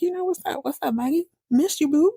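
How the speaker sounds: lightly voiced high flirt; flirty. Husband coming on to Maggie, flirty. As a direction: pitch it up, playfully coming on.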